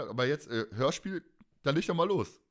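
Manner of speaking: ordinary talk, nothing marked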